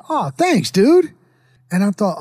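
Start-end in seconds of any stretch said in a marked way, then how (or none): 0.1-1.1 pleased thanks. Retold or imitated. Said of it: "And I'm like".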